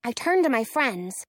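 ordinary delivery

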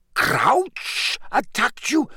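the shock grows on the repeat. A narrator's reading.

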